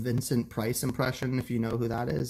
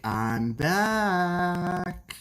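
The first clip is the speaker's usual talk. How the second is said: high, dramatic catchphrase delivery; sitcom catchphrase; 7 semitones higher than usual. Retold or imitated. Streamer hams up a sitcom catchphrase for chat. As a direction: deliver it like a sitcom character's big entrance. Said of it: "a catchphrase for a sitcom or drama character".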